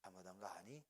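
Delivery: hushed, gentle father asking softly; soft fatherly voice. Retold or imitated. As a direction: coax the son like a kind dad. Father gently forgiving in story.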